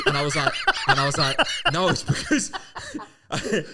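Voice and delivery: high, laughing retelling; cracking up while telling it; breaking into laughter mid-story; laughing through it. Real, not acted.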